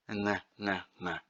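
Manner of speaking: Ng, ng hummed softly at the back of the throat. Shown as a demonstration. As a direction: hum the ng quietly, no g. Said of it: "the G sound at the back of the throat".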